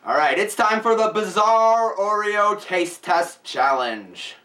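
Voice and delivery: slow, drawn-out game show announcer. Acted. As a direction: announce the title like a show host. Announces the challenge title.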